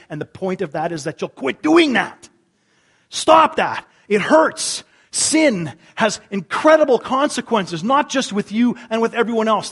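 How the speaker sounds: loud, driving pulpit preaching with a high peak on "stop that"; preaching at full force. Acted. Pastor warning his congregation about sin, preaching at full force. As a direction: hammer the warning home to the congregation.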